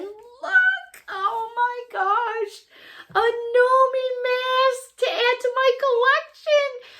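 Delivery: high, slow, sung-out gush; singsong delight. Real, not acted. Adding a mask to her collection, singsong. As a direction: croon over a new find.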